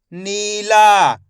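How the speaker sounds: नीला very slow, both vowels held; stretched pronunciation. Shown as a demonstration. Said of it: "मैं इसका खींच के उच्चारण कर रहा हूँ".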